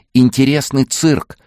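high, loud, slow excited shout; shouting over noise. Acted. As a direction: yell it into a friend's ear over applause. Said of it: "крикнула София в ухо Максима".

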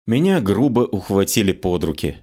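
plain reading voice

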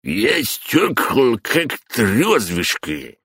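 put-on slow drunken slur; slurring drunk. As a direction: slur it like a drunk old man. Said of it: "заплетающимся языком заявил дедок". (пьяно заплетаясь)

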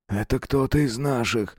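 ordinary delivery

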